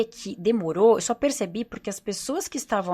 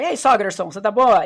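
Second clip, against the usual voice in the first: loud, jokey hello; teasing greeting; 9 dB louder than usual. Shown as a demonstration. Joking with her mother-in-law, loud playful greeting. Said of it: "Eu brinco a minha sogra".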